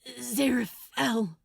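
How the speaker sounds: strained pant through clenched teeth. Acted. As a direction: squeeze it out through gritted teeth. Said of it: "he panted, through clenched teeth".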